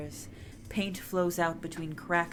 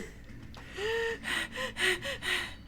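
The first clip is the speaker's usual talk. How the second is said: high laugh; 7 dB quieter than usual. Real, not acted. Laugh after axe.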